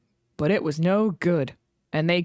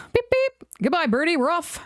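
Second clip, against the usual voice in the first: high whistling goodbye; whistled farewell; 10 semitones higher than usual. A narrator's reading. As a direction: sing out the farewell. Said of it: "whistled Edward".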